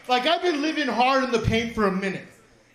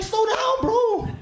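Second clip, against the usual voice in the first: higher, urgent plea to slow down; mock alarm; 8 semitones higher, slower than usual. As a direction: stop the spinning waiter, half panicked. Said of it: "I'm like".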